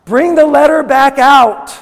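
high, very loud preacher's command; urgent, impassioned bellow; angry-sounding shout. Real, not acted. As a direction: shout it out at full force. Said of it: "I sound angry".

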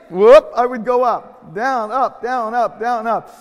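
loud, high, rhythmic calls; boisterous play-by-play of the game; bouncy re-enactment. Retold or imitated. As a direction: bounce the words like a horsey ride. Acts bouncing up and down.

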